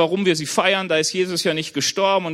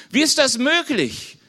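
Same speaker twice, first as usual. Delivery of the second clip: higher, astonished exclamation; astonished cry; 6 semitones higher, 3 dB louder than usual. A narrator's reading. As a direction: cry out in disbelief. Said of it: "riefen sie außer sich".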